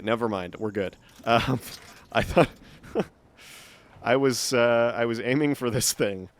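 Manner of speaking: normal talking voice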